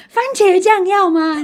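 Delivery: high, slow, chirpy mock of a cheerful vendor; sunny vendor. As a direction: drawl it out like an overly sunny shopkeeper. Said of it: "老板就拿很阳光，就说". (用高而慢、装出来的阳光语气问)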